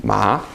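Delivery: "Ma" sweeping up from low; low start, rising end on "Ma". Shown as a demonstration. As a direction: lift "Ma" like a surprised question. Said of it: "Your voice goes up".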